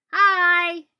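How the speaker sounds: very loud, high, drawn-out greeting; cheerful yell. A narrator's reading. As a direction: holler a cheery hello across the street. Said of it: "yelling cheerfully across the street".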